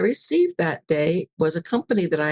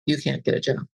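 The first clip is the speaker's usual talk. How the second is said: ego voice; 5 semitones lower than usual. Retold or imitated. Ego voice argues in her head.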